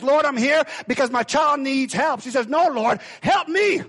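high, loud pleading cry to the Lord; desperate plea. Acted. Mother begging the Lord for her child, loud pleading cry.

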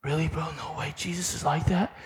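soft, awed disbelief; hushed amazement. Retold or imitated. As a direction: ask it quietly, stunned. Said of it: "And he was just like this".

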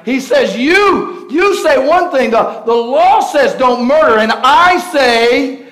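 loud, high, driving sermon voice; thundering pulpit delivery, swelling to shouts; full-force preaching. Acted. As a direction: preach it big to the whole church.

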